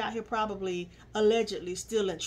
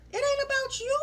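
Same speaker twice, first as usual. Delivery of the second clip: high scolding jab; faster than usual. Real, not acted. Scolding cast member.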